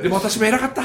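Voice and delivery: high-pitched mock plea of weariness; excuse-making husband. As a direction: plead like a worn-out rakugo husband. Husband delivers rakugo punch line.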